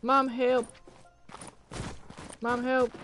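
high panicked pleading; hyped gamer bro. Real, not acted. Begging teammate mid-fight.

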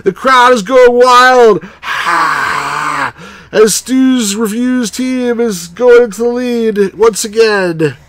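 slow, high, loud play-by-play; sports announcer. Acted. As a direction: call it like a stadium announcer. Mock sports announcer call.

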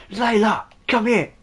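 high, loud call across a distance; shouting. Acted. Unicorn shouting at player from across the map. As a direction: holler it like a summons. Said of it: "this unicorn is just shouting at me".

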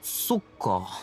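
low, stammered acknowledgement; hesitant. Acted. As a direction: falter as it sinks in. Taken aback by the explanation.

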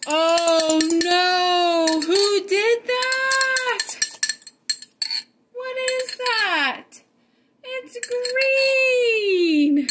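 high, slow, hammed-up alarm; mock shock. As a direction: play up the shock for the kids. Acts shocked at green egg.

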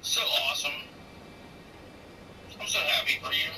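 low-pitched, understated congratulations; sarcastic. Real, not acted. Congratulating someone on a daughter-in-law, heard as sarcastic. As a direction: congratulate them in a low, doubtful-sounding voice. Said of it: "You're meaning that sarcastically".

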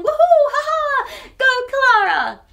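loud, high whoop; cheering. Real, not acted. Reader cheering Clara's gold star for listeners.

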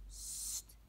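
a long unvoiced shush; hushing. Lot hushes crying Tommy so parents won't hear, whispered shush. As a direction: shush a noisy little brother.

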